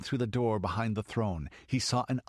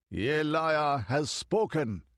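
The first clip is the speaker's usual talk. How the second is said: slow, higher, ringing proclamation; exalted cry; 6 semitones higher, 3 dB louder, slower than usual. A narrator's reading. The high priest hails the oracle's words, slow and exalted. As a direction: lift it up like a revelation. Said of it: "he cried in an exalted voice".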